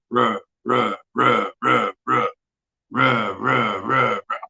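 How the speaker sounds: vocal imitation of an engine that won't start; slow ruh-ruh engine crank; sputtering engine. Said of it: "and it's cranking, like, super slow".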